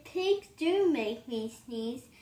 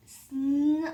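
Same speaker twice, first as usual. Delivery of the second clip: usual speaking voice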